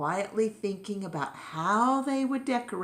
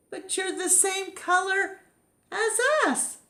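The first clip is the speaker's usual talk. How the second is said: very high astonished gasp; gasping surprise. Acted. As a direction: gasp it out in shock. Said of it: "gasped the others".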